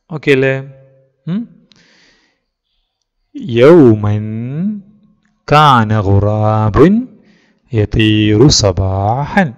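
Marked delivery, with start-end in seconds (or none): 3.6-9.5 loud, very slow, word by word; teacher reading aloud. Shown as a demonstration. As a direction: read each word out slowly for the class to follow. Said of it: "sir വായിക്കാം".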